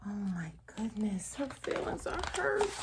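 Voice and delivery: soft whispered sympathy; sympathetic whisper. Real, not acted. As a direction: whisper as if sharing sorrow. Reader sympathizes with Night.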